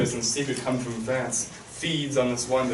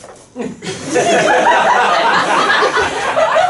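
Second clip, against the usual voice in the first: mock laugh; 10 dB louder than usual. King laughs at Laertes.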